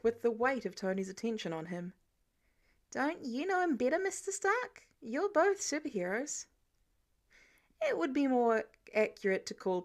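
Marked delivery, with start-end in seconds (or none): 2.9-6.5 playing innocent. Peter deflecting innocently.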